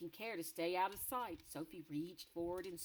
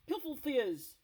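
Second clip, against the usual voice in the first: higher, louder scornful shout; giant shout; 7 semitones higher, 8 dB louder, slower than usual. Acted. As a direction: bark it out. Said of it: "shouted the blood bottler".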